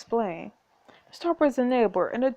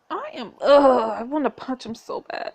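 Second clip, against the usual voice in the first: slow, loud fuming ugh; real exasperation boiling over; frustrated groan; 4 semitones higher, 5 dB louder, slower than usual. Real, not acted. Player fumes at the scheming duke, slow and loud.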